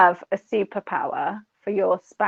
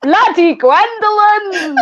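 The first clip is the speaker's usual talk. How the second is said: high, loud, drawn-out mock curse; mock outraged cry; 9 semitones higher, 5 dB louder, much slower than usual. Prank victim cursing the mystery caller, high mock outrage.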